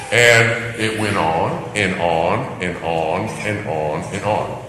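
ordinary talk

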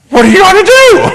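very high, loud comic squeal; hammed-up loud falsetto question; mock helplessness. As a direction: squeal it in exaggerated helpless alarm. Mocking parents who can't say no.

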